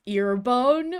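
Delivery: usual speaking voice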